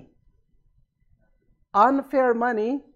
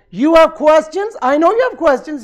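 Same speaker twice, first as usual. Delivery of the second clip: a lively, louder knowing challenge to Saad; eager urging; 5 semitones higher, 9 dB louder than usual. Retold or imitated. Imam urges Saad to ask, raised knowing voice. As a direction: press him warmly, half teasing.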